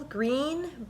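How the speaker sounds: usual speaking voice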